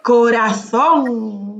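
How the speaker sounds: Corazón very slow, long accented -zón; stress on the last syllable. Shown as a demonstration. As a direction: stretch out the O of Corazón. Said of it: "corazón con el acento en la O". (Corazón muy lento, alargando la ó)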